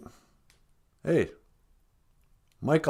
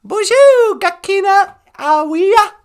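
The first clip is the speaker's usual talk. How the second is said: much higher, louder puppet voice; high puppet greeting; 11 dB louder, slower than usual. Pretend. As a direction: greet everyone as a squeaky puppet.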